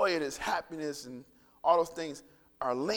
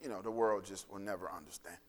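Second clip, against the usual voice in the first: low, earnest pastoral prayer voice; 3 semitones lower than usual. Acted. Pastor praying for the congregation, earnest and low. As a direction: pray over the congregation, unhurried.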